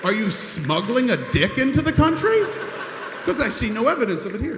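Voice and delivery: high, loud hammed-up officer taunting; mock guard shout. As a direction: bark it like a smug customs officer. Acted border guard taunting.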